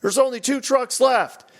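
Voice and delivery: high, slow mock alarm; drawn-out high character voice; mimicked game call-out. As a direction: imitate a control room report, pitched well up. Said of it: "then the guy".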